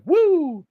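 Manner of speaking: high, loud crowd-style "woo"; imitated fans' whoop. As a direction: whoop like a wrestling crowd joining in. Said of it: "Now the fans are like".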